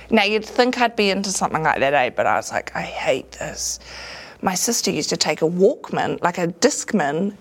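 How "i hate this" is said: whispered, slow grumble; muttered complaint. Retold or imitated. Stuck in the eagle suit, slow whispered complaint. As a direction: whisper it slowly under your breath. Hating the eagle mascot shift.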